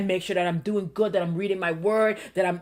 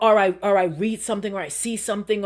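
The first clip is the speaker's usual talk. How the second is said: usual speaking voice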